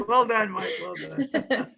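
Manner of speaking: real chuckle through the words; laughing praise. Real, not acted. Guest amused by host's brogue attempt, laughing. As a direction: congratulate him while laughing.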